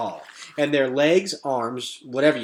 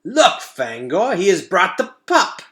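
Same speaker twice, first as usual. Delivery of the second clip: slow, relishing giant rat; 3 semitones higher, 6 dB louder than usual. Acted. Giant rat greeting its prey with slow relish. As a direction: savour each word like a hungry rat. Rat hails the captive boy.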